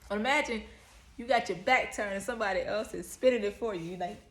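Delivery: loud, high, laughing retelling; excited laughing. Real, not acted. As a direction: tell it loudly through laughter.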